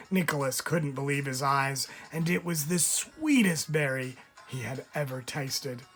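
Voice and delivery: ordinary delivery, nothing marked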